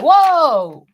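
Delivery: high, loud mock amazement; amazed whoa. King Kane amazed at Oliver's body, high loud whoa. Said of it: "he was like".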